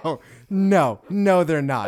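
indignant protest. Real, not acted. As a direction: protest the question loudly in playful outrage. Outraged at trick quiz question.

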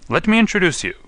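high, loud triumphant announcement; shouting. Acted. Holmes unmasking the prisoner to the inspector, shouting. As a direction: shout it out like a grand reveal. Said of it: "he shouted".

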